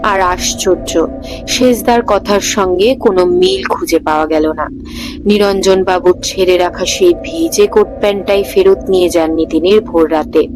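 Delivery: usual speaking voice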